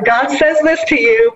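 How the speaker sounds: high, lightly hammed-up solemn voice; mock divine proclamation. Guest jokingly imitating how God would speak, high mock solemnity. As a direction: proclaim it like a playful oracle. Voicing God's message.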